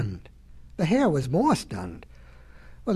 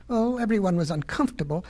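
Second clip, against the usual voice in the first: ordinary delivery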